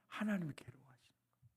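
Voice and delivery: quick hushed whisper. Acted. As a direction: lean in and whisper it fast. Whispered sermon point. (빠르게 속삭이듯)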